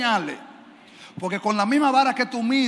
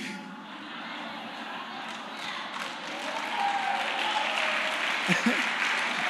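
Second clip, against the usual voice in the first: laugh; 6 dB quieter than usual. Real, not acted. Pastor se ríe triunfante.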